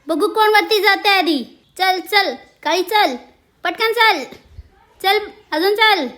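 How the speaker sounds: loud, high cheering on; urging on. Real, not acted. Urging cats in climbing race.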